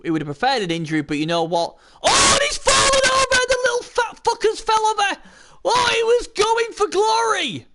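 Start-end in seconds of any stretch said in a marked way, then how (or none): 2.0-7.7 very high, loud commentator outburst; excited shout. Real, not acted. Commentator sees the runner fallen over, excited shout. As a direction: shout in disbelief at a sudden tumble. Commentator reacts to sudden fall.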